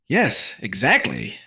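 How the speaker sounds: excited shout. Acted. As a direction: call it out eagerly. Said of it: "Fancy Pants shouted".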